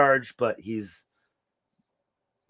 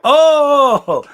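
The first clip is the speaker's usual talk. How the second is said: high, loud startled Oh; sudden loud surprised cry; shocked gasp; 14 dB louder than usual. Retold or imitated. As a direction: yelp it out like you've just been flirted with. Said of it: "I was like".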